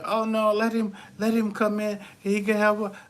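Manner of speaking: high, mock-sweet imitation of the young ladies; imitating the ladies. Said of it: "you know how the women are".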